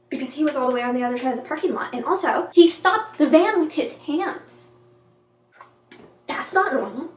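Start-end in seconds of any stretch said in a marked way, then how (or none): 2.5-4.7 slow, high and loud, each word weighted; incredulous emphasis. Real, not acted. Vlogger recapping an impossible rescue, slow incredulous emphasis. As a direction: drag it out in disbelief, raised voice.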